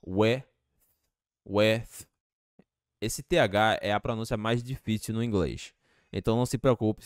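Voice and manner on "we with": WE as "weh", then WITH ending in a puff of air; WITH finished on an airy, voiceless TH; TH as released air. Shown as a demonstration. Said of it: "você só vai soltar um ar".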